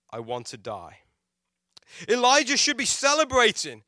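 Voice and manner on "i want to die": much softer and lower, fading out; hopeless prayer. Retold or imitated. Elijah on his knees telling God, quiet and low. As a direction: let the wish to die sink to almost nothing. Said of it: "now on his knees before God, saying".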